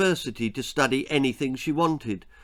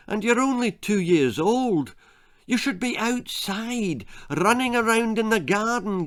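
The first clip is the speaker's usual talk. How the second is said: high, pleading mother voice, slowing to the end; exasperated mother; 8 semitones higher than usual. A narrator's reading. Mother pleads with her toddler daughter, high and exasperated.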